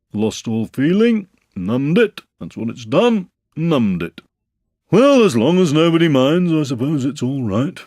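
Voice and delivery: a put-upon donkey moaning about his tail; Eeyore complaining. Acted. Eeyore complains to the others about his cold tail, gloomy.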